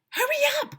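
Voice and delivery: high, loud urgent call; man shouting. A narrator's reading. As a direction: yell for the kids to hurry. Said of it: "Mister Cooper shouted to us".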